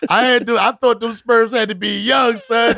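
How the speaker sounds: high, loud disbelieving rant; incredulous rant. Real, not acted. Telling the host about late fatherhood, loud incredulous rant. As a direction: shout it like you can't believe it.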